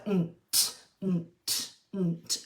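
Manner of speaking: "nt" repeated slowly, n and t pulled apart; split consonant sounds. Shown as a demonstration. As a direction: say "nt" in two clear sounds. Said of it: "break that sound apart".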